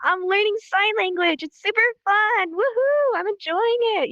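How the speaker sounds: high, animated, exuberant greeting; leaning into it. Shown as a demonstration. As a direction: gush with full enthusiasm, like greeting a buddy. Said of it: "It's okay to lean into it".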